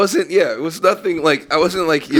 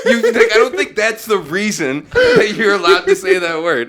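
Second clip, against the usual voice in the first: higher, laughing disbelief; 8 semitones higher than usual. Retold or imitated. Retelling his reply to the Irish guy, laughing. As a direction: protest through laughter. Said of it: "And I'm like".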